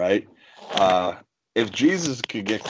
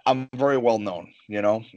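normal talking voice

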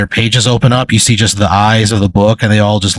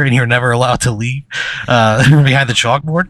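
normal talking voice

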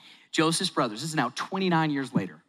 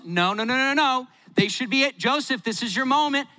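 rising protest; 7 semitones higher than usual. Real, not acted. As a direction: build up the objection. Preacher protests Joseph's mercy.

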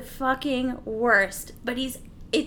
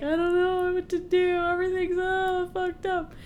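mock whining; 7 semitones higher than usual. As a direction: imitate a pathetic man wailing higher. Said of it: "just how he's just like".

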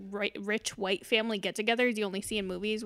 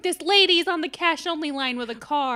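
loud, higher mock complaint; acted indignant tattling; mad customer; 5 semitones higher, 4 dB louder than usual. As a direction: announce her mistake to the whole queue. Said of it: "He turns around and he's like".

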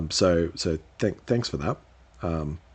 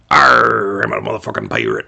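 much lower swaggering pirate voice; 12 semitones lower, 4 dB louder than usual. Acted. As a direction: growl it out like a proud pirate captain. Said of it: "I'm sticking with his pirate voice".

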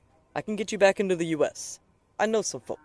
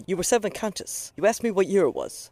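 ordinary delivery

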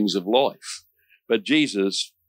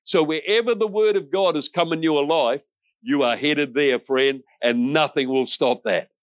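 high, driving pulpit preaching; preaching at full force; 6 semitones higher than usual. Acted. Pastor rallying his congregation with a fired-up preaching voice. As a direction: preach it out to the whole room.

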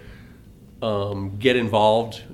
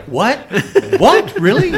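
high, loud disbelief; shocked double take; surprised; 12 semitones higher, 8 dB louder than usual. Retold or imitated. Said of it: "I was like".

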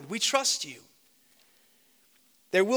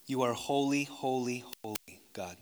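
pastoral prayer; 3 semitones lower, 3 dB louder than usual. Real, not acted. Pastor praying over the congregation, reverent then fervent. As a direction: pray reverently, then plead with growing force.

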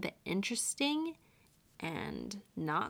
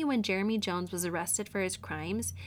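ordinary talk, nothing marked

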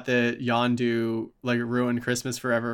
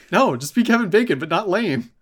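high, loud imitated scolding; mock exasperation; 7 semitones higher, 5 dB louder than usual. Character orders the actor to be himself, mock exasperation. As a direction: cut him off, hammed-up and fed up.

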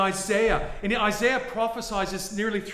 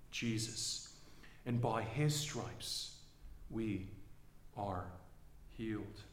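soft, low and slow scripture reading; hushed reading; 10 semitones lower, 13 dB quieter, slower than usual. Acted. Preacher closing Isaiah in a soft, low hush. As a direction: let it fall to a reverent hush. Quiet reverent close of reading.

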